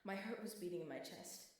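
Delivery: usual speaking voice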